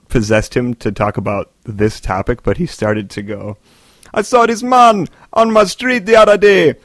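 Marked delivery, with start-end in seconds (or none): 4.1-6.8 loud, high mock-Jamaican rant; scolding a fool in a hammed-up Jamaican accent. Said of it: "he started into a Jamaican accent".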